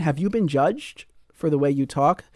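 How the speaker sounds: ordinary talk, nothing marked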